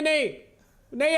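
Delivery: ordinary delivery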